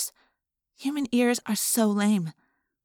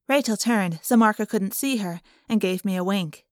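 ordinary delivery

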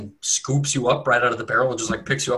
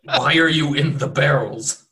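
gruff orc demand; 3 semitones higher, 5 dB louder than usual. Acted. Orc leader presses louder.

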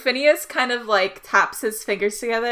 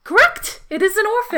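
triumphant quizmaster; 11 semitones higher than usual. Acted. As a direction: announce the winning answer in a delighted squeal. Quizmaster confirms right answer.

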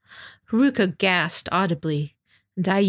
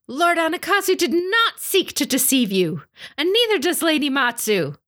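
sudden shout across the assembly; 10 semitones higher than usual. A narrator's reading. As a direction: blurt it out before thinking. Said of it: "Tsunuri found herself shouting".

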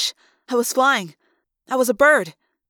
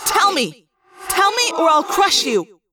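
echoing, higher demanding shout; yelled threat; 4 semitones higher, 4 dB louder than usual. A narrator's reading. Confused Rachel threatening the girl, echoing yell. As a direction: threaten forcefully in thought-speak. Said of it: "I yelled in thought speak".

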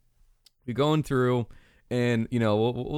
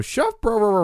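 high loud chant of bro; playful mock falsetto intro chant; knockoff chef call; 12 semitones higher, 4 dB louder than usual. Shown as a demonstration. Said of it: "I usually go".

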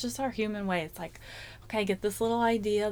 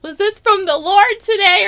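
much higher, loud despairing outcry; exasperated cry; 12 semitones higher, 16 dB louder than usual. Retold or imitated. Overwhelmed mother crying out to God, loud and high. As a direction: throw it up to heaven in exasperation. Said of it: "where I'm just like".

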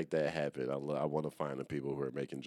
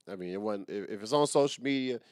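normal talking voice

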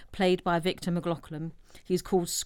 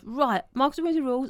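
mock agreement; 8 semitones higher, 4 dB louder, faster than usual. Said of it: "And he goes".

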